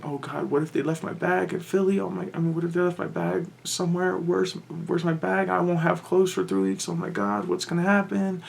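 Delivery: high, anxious rush of worried questions; panicked worrying. Retold or imitated. After landing, worrying about her bag, in a high panicky voice. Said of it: "And I start to freak out".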